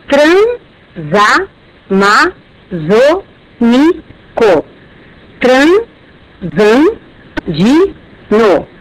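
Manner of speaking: "transamazônico" and "transandino" stretched syllable by syllable, s joining the next vowel; very slow syllable split. Shown as a demonstration. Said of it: "Agora repare". (bem devagar, tran-sa-ma-zô-ni-co, tran-san-di-no)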